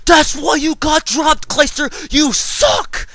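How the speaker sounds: high, loud mocking yell at a player; screamed taunt. Heckler in the crowd screaming at the player. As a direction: scream it from the stands, slow and shrill with scorn. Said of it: "would stamp and scream at the top of his lungs".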